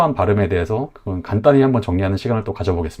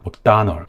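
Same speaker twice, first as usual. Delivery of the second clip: weak-STRONG-weak "맥도날드"; second-syllable stress. Shown as a demonstration. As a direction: punch the middle of "맥도날드", swallow the rest. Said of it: "두 번째 음절만 강하게 발음하시고".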